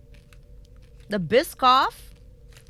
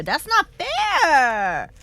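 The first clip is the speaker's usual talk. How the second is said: indignant; 4 semitones higher, 7 dB louder, much slower than usual. Real, not acted. Complaining about cookie sizes.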